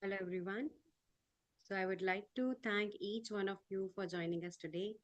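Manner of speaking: very faint, far-off mic; barely audible. Real, not acted. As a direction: voice almost lost, mic too low. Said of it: "your voice is very low".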